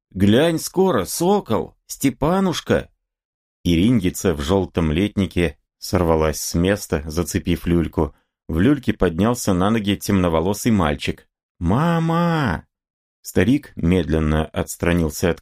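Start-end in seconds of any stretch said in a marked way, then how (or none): 0.1-2.9 high, delighted greeting; eager, high-voiced affection; joyful welcome. A narrator's reading. Woman joyfully greets her beloved. 11.6-12.7 put-on high, little boy's call; high childish cry for mother; small child calling. A narrator's reading. Small boy calls from the cradle.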